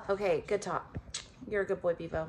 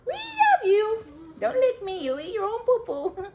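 high teasing pet voice; 13 semitones higher, 4 dB louder than usual. Real, not acted. Owner teasing her dog in high baby talk. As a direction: coo and tease the dog up high.

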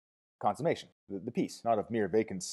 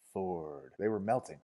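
diminishing; 2 semitones lower, much slower than usual. A narrator's reading. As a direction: let it sink and fade. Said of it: "it said diminishingly on a descending scale".

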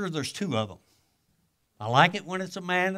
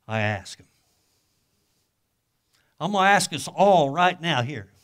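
ordinary delivery

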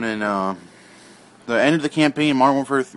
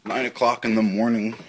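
ordinary delivery

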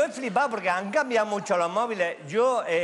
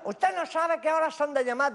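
put-on young foreign woman; 6 semitones higher than usual. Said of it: "una voz de chica joven con acento extranjero".

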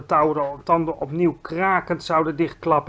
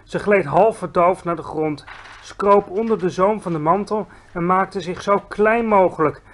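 plain reading voice

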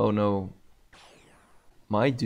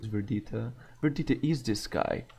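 usual speaking voice

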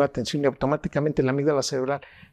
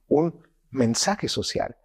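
ordinary delivery

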